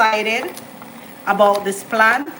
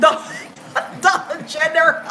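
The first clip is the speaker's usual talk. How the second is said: laughing; 7 semitones higher than usual. Real, not acted. Laughing at the only store.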